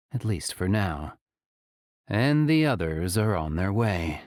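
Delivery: plain reading voice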